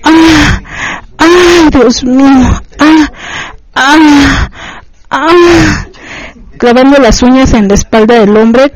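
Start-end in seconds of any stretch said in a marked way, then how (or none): 0.0-5.9 high drawn-out acted cries; repeated high outcries; long cry. A narrator's reading. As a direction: cry out in long, raised bursts. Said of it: "Un grito largo".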